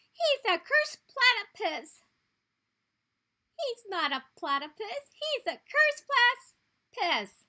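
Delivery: high, loud mocking taunts; teasing. Skink taunts the platypus, loud and jeering. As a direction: jeer at him. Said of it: "he teased".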